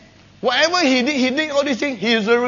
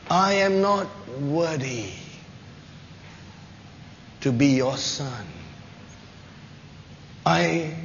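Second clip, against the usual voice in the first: humble prodigal son; 4 semitones lower, 7 dB quieter than usual. Acted.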